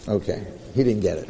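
ordinary delivery

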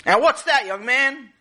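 high, loud demanding question; exasperated farmer. Acted. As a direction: demand an answer in a high, scolding voice.

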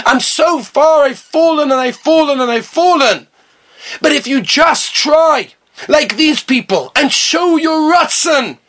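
high, drawn-out, fervent exhortation; impassioned preaching. Real, not acted. Preacher urging listeners to want closeness to Hashem, impassioned. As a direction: preach it with mounting fervour, stretching key words.

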